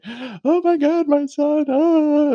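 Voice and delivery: very high, drawn-out wail; mock freak-out. Parent hearing their son is hurt, mock hysterical wail. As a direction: wail it in a hammed-up falsetto, stretching the words. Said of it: "I'm just, like, freaking out".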